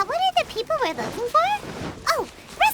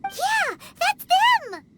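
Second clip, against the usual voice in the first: excited; 8 semitones higher, much slower than usual. Acted. Recognizes the missing miners.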